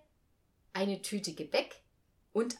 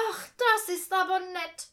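put-on higher, louder old woman voice; a delighted old lady being invited; 10 semitones higher, 8 dB louder than usual. A narrator's reading. Old Frau Strehlen thanks Uncle Jupp, delighted old voice. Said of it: "sagte Frau Strehlen".

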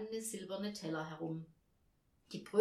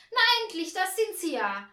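high, loud relieved exclamation; impatient hostess; 12 semitones higher, 12 dB louder than usual. A narrator's reading. Woman opening the door to a late helper, loud and impatient. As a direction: burst out shrilly, as if kept waiting.

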